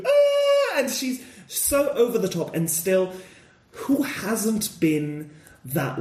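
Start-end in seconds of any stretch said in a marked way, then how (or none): 0.1-0.8 high, loud mock wake-up scream; a sudden high shriek on waking; imitated scream. Mimicking the heroine waking with a shriek. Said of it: "she goes".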